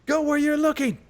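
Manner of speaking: high mock angry retort; troll shouting back. The troll shouts back at the cross-eyed orc, high and mock angry. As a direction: snap it back like a cranky troll. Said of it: "The troll shouts angrily back".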